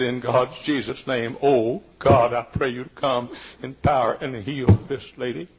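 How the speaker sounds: loud, slow, pleading prayer to God; fervent prayer. Retold or imitated. Pastor at the bedside, praying loudly for healing. Retold prayer in full force.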